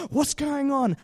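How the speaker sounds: sharp gasp then a high startled question; gasp, then ask in alarm; surprised gasp. Acted. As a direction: crowd gasping in shock. Acted crowd reaction.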